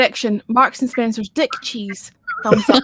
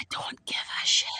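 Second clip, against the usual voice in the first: whispering; 12 dB quieter than usual. Real, not acted. Whispered aside in group call.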